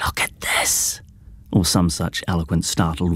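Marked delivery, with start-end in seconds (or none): 0.1-1.0 soft breathy whisper. A narrator's reading. The girl marvelling at the book, whispered. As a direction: hush it in wonder. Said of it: "picked it up and whispered".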